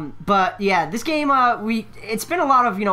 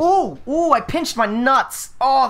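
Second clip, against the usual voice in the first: higher-pitched, drawn-out groaning complaint; pained yelp; 7 semitones higher, 3 dB louder, slower than usual. Real, not acted. Player tells friends he pinched himself, pained. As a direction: cry out at a sudden painful pinch.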